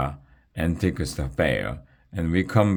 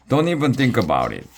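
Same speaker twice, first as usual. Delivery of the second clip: fast, high, curt warning; snapped; 8 semitones higher, 4 dB louder, much faster than usual. A narrator's reading. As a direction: cut them off sharply. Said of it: "one dragon snapped".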